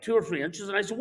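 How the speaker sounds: usual speaking voice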